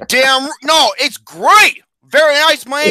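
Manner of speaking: loud, high, excited protest; hyped-up enthusiasm. Acted. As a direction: burst out with over-the-top enthusiasm. Hyped-up rebuttal to flat praise.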